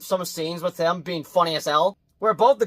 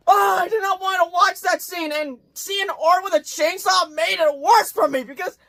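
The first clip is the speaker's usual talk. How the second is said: high-pitched horrified protest; distressed recoil; 12 semitones higher than usual. Real, not acted. Reviewer reliving the chainsaw scene, high-pitched distress. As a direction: wail it, voice pushed way up.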